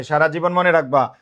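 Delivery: usual speaking voice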